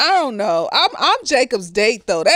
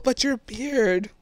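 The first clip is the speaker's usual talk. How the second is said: slow, drawn-out deadpan line; lightly voiced dumbfounded teacher; mock quote; 3 semitones lower, 6 dB quieter, slower than usual. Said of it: "and I was just like".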